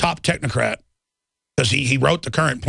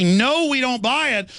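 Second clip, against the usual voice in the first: defiant refusal; 8 semitones higher, slower than usual. Pretend.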